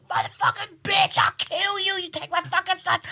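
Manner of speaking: high, screaming rage at the teacher; furious shout. Retold or imitated. Mom screaming at the teacher who took her son's shirt, furious. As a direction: scream it like a mother ready to fight. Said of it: "She's like, she's like".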